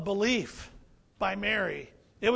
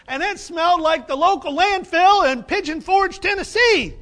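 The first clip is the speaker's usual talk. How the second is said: pitched up and loud for the joke; louder, higher comic jab; loud comic punchline; 8 semitones higher, 11 dB louder than usual. Real, not acted. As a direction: punch the landfill line. Comic punchline about landfill.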